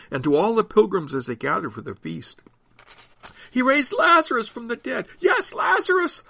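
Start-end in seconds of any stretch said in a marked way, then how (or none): 3.5-5.1 high-pitched crowd call; shouting. A narrator's reading. Onlooker spreading news of Lazarus, high shout. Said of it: "hear Lazarus' name shouted out". 5.2-6.2 very high answering call. A narrator's reading. Another onlooker confirming the miracle, high call. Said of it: "another would call".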